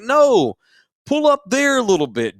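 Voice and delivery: loud high command; insistent yell. Retold or imitated. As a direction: make him stop at a real place. Ordering driver where to stop.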